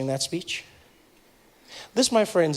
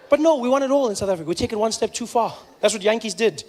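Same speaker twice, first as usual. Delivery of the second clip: ordinary delivery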